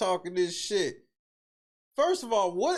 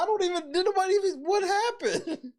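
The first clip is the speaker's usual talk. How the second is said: high, rushed stammer slowing on the last question; baffled, voice pitched way up; bewildered; 12 semitones higher than usual. Real, not acted. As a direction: splutter in amused confusion. Bewildered, laughing at the clip.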